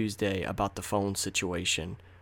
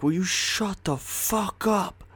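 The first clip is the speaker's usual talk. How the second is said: much higher, slow, furious snap; angry retort; 11 semitones higher, slower than usual. Retold or imitated. Angel rounding on Harry Potter, a slow, furious high snap. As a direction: drag out the words at him in anger. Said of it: "He was so mad".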